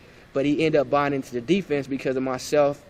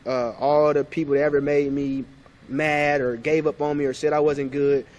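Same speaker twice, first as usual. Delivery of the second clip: normal talking voice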